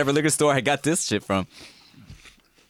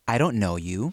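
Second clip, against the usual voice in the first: low, condescending put-down; mock-superior, talking down to a customer; condescending barista; 8 semitones lower, 3 dB quieter than usual. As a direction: imitate a dismissive barista, voice dropped low. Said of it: "Just super condescending".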